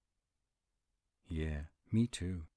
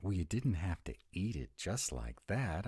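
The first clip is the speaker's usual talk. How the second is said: usual speaking voice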